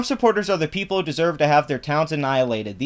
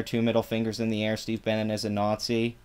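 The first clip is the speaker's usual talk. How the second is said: quiet, lower, sneering repeat of the line; mocking mutter; 5 semitones lower, 7 dB quieter than usual. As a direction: say it low and scornful, like a put-down.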